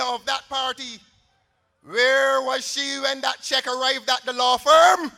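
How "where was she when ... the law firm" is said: challenging shout. Real, not acted. Rally speaker challenging opponent.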